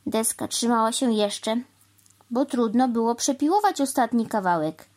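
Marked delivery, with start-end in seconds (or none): none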